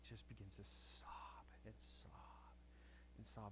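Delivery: dropped to a near whisper; hushed. Real, not acted. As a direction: let the words fall to a hush. Preacher retells tender moment.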